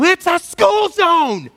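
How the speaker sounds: high, drawn-out shout out the car window; angry yell. Retold or imitated. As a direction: yell it, mad, stretching the words. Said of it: "And I was mad".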